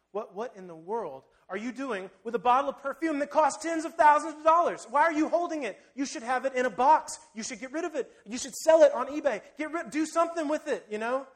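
rising to a loud, high scold; indignant scolding. Acted. Disciples scold the woman with the perfume, loud and indignant.

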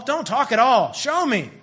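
loud, high, insistent outburst; hammed-up insistent command; impatient demand. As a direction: demand it forcefully, out of patience. Eliza demanding proof of love.

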